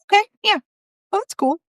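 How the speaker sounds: high, lightly voiced ghost woman won over; a hint of a cheerful ghost lady agreeing; pleased spirit voice. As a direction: chirp it as a ghost who is suddenly happy. Spirit woman accepts the idea.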